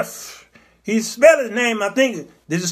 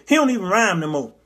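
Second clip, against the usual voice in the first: ordinary talk, nothing marked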